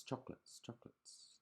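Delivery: whispered, fading echo. Acted. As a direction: breathe it out like a distant echo. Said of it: "the voice from the cave replied".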